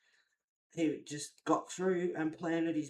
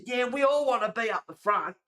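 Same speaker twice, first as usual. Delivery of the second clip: high, raised-voice comeback; loud retold retort; 7 semitones higher, 9 dB louder than usual. Streamer retelling answering a pusher at the front, loud retort. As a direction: throw it back at them loudly.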